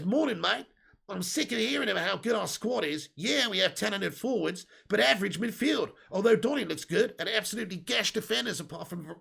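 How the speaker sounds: botched attempt at an Aussie accent; hammy, off-target Aussie accent; failed Australian accent. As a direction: put on a bad Australian twang for the quote. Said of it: "that was not even an Australian accent".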